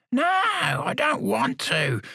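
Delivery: high, slow stubborn refusal; high, drawn-out insistence; insistent old man. Acted. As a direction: dig in like a stubborn old lag. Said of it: "the old lag insisted".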